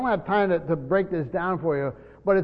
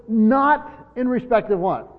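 slow, raised "Not" set firmly at the front; strong negative; 4 semitones higher, 3 dB louder, slower than usual. Acted. Preacher quoting Paul's strong negative, slow and emphatic. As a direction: lean hard on "Not", then measured through the rest. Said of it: "it's a strong negative".

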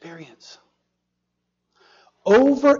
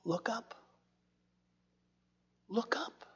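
higher, much softer, whispered rising plea; soft urging; 5 semitones higher, 16 dB quieter than usual. Acted. Preacher softly urging his congregation to look up, whispered. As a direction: gently coax them to look up.

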